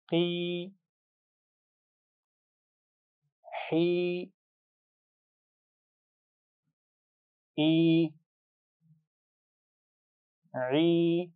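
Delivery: की, ही, ई, ई each held long, the two ई kept distinct; long ee sounds. Shown as a demonstration. Teacher models long ee for the class: held and distinct. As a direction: stretch every ee, separate hamza from ain. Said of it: "हमजा और आइन की आवाज़ में फ़र्क वाज़े रखिए".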